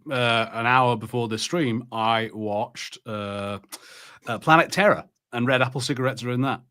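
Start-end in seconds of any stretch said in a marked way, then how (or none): none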